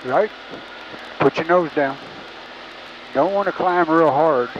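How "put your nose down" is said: ordinary talk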